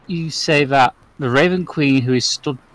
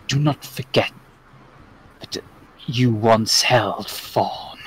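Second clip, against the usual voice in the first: goddess's reproach sinking into a whisper, long pauses; Raven Queen; 3 semitones lower, 4 dB quieter than usual. Acted. Raven Queen glaring at Asmodeus, threatening.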